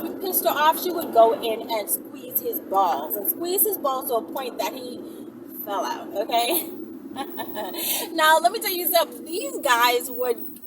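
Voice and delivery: ordinary talk, nothing marked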